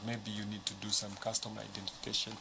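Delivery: usual speaking voice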